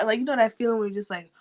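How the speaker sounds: usual speaking voice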